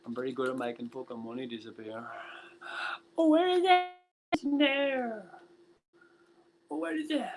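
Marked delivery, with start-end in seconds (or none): 3.2-7.2 very high falsetto squeal; mock searching voice. Streamer playing at hunting for vanished money, mock high squeal. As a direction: squeak it out in a silly high put-on voice.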